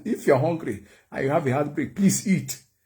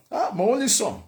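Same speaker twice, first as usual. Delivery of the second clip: worried mother; 8 semitones higher than usual. Retold or imitated. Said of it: "My mom said".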